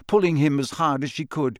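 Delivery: ordinary delivery, nothing marked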